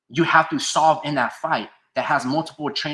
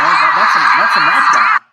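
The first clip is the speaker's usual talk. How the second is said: loud, high insistence; shouting; 4 semitones higher, 13 dB louder than usual. Real, not acted. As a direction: yell the call at chat. Stream host shouting an argument.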